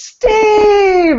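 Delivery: very high, loud, drawn-out squeal of his name; huge-deal greeting. Retold or imitated. Wife making a huge deal of husband coming home, squealing. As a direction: shriek it like a thrilled reunion. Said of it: "makes this huge deal".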